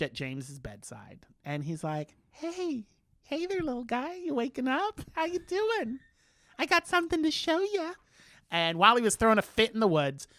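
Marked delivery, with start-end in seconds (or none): 2.3-7.9 put-on high, sweet baby-talk coo; cooing George. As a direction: coo up high like waking a little one. George cooing to sleeping James.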